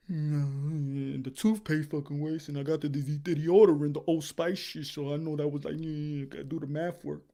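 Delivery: low, soft, rambling excuse voice; mumbling fan voice. Pretend. Fan explaining cheap expenses to Teofimo, low and soft. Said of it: "voicing the fan's expenses".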